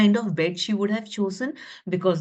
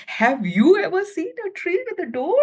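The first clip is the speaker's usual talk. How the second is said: excited; 8 semitones higher than usual. A narrator's reading. Asks children with wonder.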